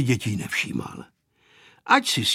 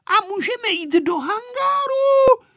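put-on very high, loud child's question; girl shouting; 5 dB louder than usual. A narrator's reading. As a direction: shout it eagerly in a little girl's voice. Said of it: "křičela Aneška". (vysokým dívčím hláskem křičet)

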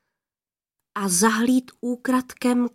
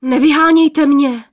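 startled, louder and higher; alarmed plea; 4 semitones higher, 8 dB louder than usual. A narrator's reading. Young man begs the maidens to let him stay, alarmed. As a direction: plead in alarm, voice raised. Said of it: "lekl se poplašený mládenec".

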